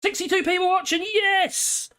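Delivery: high, delighted shout of yes; thrilled by the viewer count, pitch jumps up; excited cheer. Real, not acted. Streamer cheering the viewer count, high and delighted.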